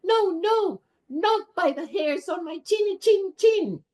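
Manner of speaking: teacher voicing the first pig refusing the wolf; little pig. Shown as a demonstration. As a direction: squeal it like a scared little pig. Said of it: "And the first little pig".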